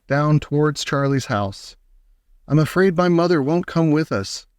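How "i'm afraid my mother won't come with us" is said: ordinary delivery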